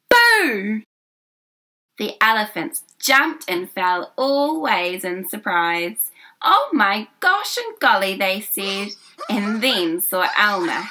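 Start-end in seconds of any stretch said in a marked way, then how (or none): 0.1-0.8 loud high playful shout; shouted boo. Acted. Elmer shouting to startle the serious herd. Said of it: "at the top of his voice shouted". 6.4-8.1 surprised. Acted. Startled elephants exclaim.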